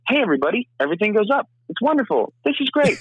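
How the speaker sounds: very high, upbeat voice where everything goes up; Spider-Man voice. Shown as a demonstration. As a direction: pitch it way up like a cheery Spider-Man. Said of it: "I'll give you an example here".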